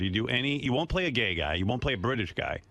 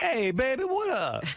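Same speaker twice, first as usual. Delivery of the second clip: much higher, loose put-on voice; hammed-up street-slang greeting, pitched way up; 13 semitones higher, slower than usual. Shown as a demonstration. Host demonstrating the role he asks for, high caricature voice.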